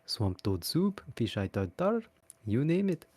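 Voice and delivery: lightly voiced gruff ogre, shy; a hint of a bashful ogre. Narrator as Shrek offering swamp food to Fiona.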